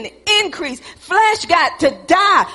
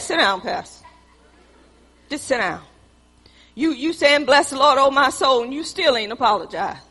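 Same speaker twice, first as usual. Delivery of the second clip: mild Teresa voice; 4 semitones lower, 3 dB quieter than usual. Retold or imitated.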